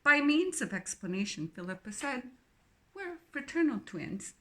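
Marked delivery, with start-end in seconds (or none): 2.9-4.3 put-on strong English accent. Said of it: "her English accent strong".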